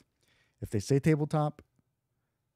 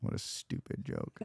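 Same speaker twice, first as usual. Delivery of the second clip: slow, very low, soft mutter; self-mocking undertone; 12 dB quieter than usual. Real, not acted. Joker disowning the amateur tractor pun, self-mocking undertone. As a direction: mutter it slowly under the breath.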